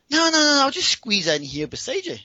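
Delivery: very high, loud rush of noes, then settling; imitated tipsy woman. Tipsy woman insisting on squeezing in beside him, imitated high insistence. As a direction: wave it away in a high, pushy voice. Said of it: "she actually is pretty intoxicated".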